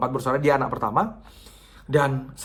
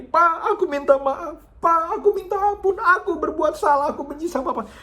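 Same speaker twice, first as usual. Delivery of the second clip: much higher, louder, cry-like begging; tearful pleading; 5 dB louder than usual. Acted. Daughter hugging her sleeping father's feet, sobbing. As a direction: plead for forgiveness through tears. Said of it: "sambil dia bilang ini".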